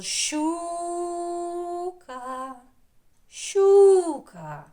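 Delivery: calling the pike with a long, loud «щу». Pretend. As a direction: call «Щука» high and ringing, like hailing someone. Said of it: "кличемо". (голосно кличе щуку, протягуючи «у»)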